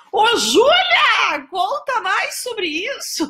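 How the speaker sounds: drawn-out high teasing shout of Ô, Júlia; excited call. Real, not acted. Teasing a viewer about her new job, high excited call.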